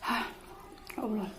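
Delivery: soft gasp of a burning mouth; mouth burning from spicy food. Real, not acted. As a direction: sigh it out, mouth on fire. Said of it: "Pedes banget".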